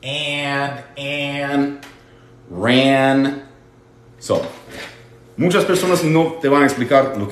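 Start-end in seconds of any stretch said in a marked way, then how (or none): 0.0-1.8 An said through the nose; nasal an. Shown as a demonstration. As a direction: nasalise An, soft. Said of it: "un sonido bien nasal". (An nasal y suave)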